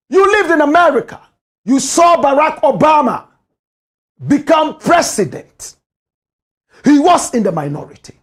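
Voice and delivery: loud, high furious rebuke at the woman; angry shout. Real, not acted. Ranting commentator shouting at the woman. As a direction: shout it out, outraged.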